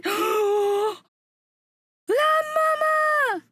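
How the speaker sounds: high, stretched-out squeal; excited cry. Real, not acted. Streamer recognising an artist's mama, excited cry.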